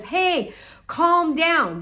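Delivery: high, loud call to a panicking man. Acted. Paul calling to the prison guard, calling out. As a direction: call out urgently, pitched well up.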